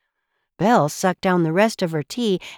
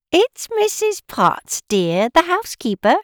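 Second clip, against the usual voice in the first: motherly housekeeper; 9 semitones higher, 4 dB louder than usual. Acted. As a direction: sing out a kindly introduction in a high voice. Housekeeper teapot introduces herself.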